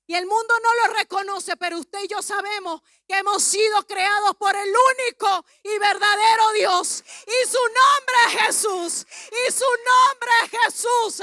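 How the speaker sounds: loud sermon building to a shout; rousing preacher's declaration; full-force preaching. Real, not acted.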